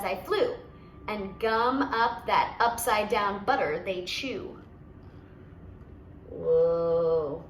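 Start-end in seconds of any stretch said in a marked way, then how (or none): 6.3-7.5 low drawn-out whoa; amazed whoa. Real, not acted. Reader to listener, a low amazed whoa. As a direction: marvel at the contraption. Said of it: "reader reacts in own voice".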